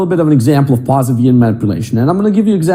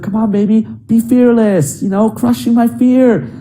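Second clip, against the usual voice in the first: put-on coaxing girlfriend; 7 semitones higher than usual. Girlfriend coaxing him again.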